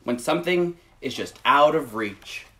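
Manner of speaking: gentle, measured picture-book read; reading to children. Acted. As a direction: read it slowly to little listeners. Reads picture book aloud.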